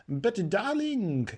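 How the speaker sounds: slow, lazy, loud darling; high-society girl, unbothered; loud drawl. A narrator's reading. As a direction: drawl it out carelessly. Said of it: "in the same loud voice".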